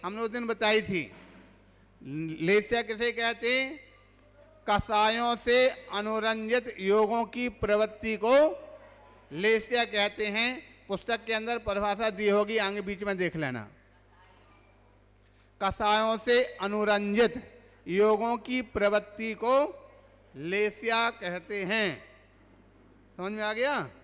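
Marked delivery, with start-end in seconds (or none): 4.7-10.9 slow, measured recitation of a definition; slow dictation. Real, not acted. Teacher recites definition for students. 15.6-22.0 slow, measured recitation of a definition; slow dictation. Real, not acted. Teacher repeats definition for students.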